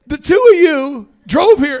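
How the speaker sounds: loud disbelief. Retold or imitated. Retold incredulous remark.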